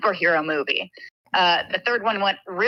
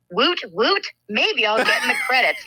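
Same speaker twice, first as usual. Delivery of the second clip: high, chirpy woot woot; a giddy high woot woot; gleeful teen line; 6 semitones higher than usual. Retold or imitated. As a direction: pipe it up like a giddy kid. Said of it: "And then I say my line".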